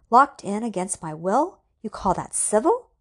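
loud, indignant shout; voice rising. A narrator's reading. Alex locked in by his computer, loud outrage. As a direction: raise your voice in outrage. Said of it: "his voice rising".

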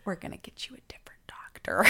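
quick secretive whisper; imitated hushed nurse; conspiring nurse. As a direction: whisper the plan fast, like a co-conspirator. Nurse plots with patient.

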